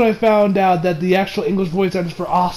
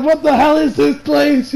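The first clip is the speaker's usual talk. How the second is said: mock-horrified, higher and slower quote; 7 semitones higher than usual. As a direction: imitate someone aghast, pitched up. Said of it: "Izuku is horrified".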